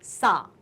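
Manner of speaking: "सा" with a plain s; simple dental sa. Shown as a demonstration. Teacher naming the simple sound, "सा" with clean s. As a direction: say "सा" drawn out, s as in सरोता. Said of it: "ये क्या है? Simple सा".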